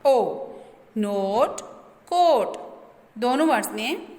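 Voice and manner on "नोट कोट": "नोट, कोट" with O sounding like its name; long O sound. Shown as a demonstration. Teacher shows students the long O, clear oh in "नोट, कोट". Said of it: "ओ के name की sound".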